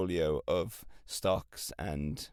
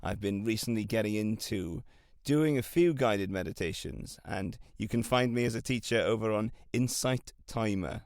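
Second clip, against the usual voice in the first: usual speaking voice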